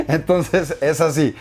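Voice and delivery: real laugh, then slow, amused; laughing at himself. Real, not acted. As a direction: chuckle after showing off the voice. Laughs after his own demo.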